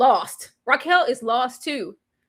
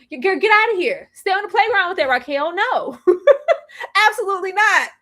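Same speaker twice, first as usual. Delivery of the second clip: high, loud mock brush-off; hammed-up "girl, get outta here"; sassy dismissal; 7 semitones higher, 4 dB louder than usual. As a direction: shoo her away, high and sassy. Therapist dismissing Raquel.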